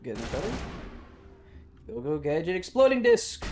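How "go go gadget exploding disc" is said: mock gadget call. Inspector Gadget style callout.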